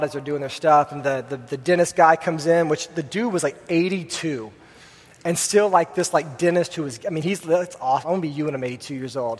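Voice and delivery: ordinary delivery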